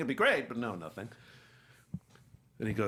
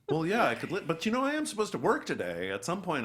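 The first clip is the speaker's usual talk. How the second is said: higher-pitched, flustered objection; flustered protest; 7 semitones higher, 4 dB louder than usual. Retold or imitated. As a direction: protest haltingly, pitch raised. Flustered reply to being sent away.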